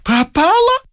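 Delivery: very high squeak; a sudden high-pitched squeaked question. A narrator's reading. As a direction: let the fear squeak out. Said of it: "пискнул Воробьянинов".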